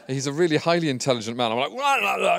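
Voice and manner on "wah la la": 1 mock babble. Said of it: "and he's not like that at all".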